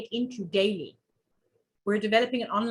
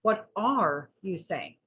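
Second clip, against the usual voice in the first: "are" drawn out slowly as the key word; stress on ARE; 2 semitones lower, much slower than usual. Shown as a demonstration. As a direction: lean hard on "are", stretching it. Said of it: "put emphasis on each word separately".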